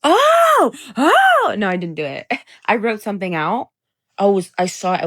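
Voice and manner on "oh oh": squealed high and loud; mock crazy girlfriend. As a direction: shriek it like an unhinged ex. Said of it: "I was gonna be crazy and be like".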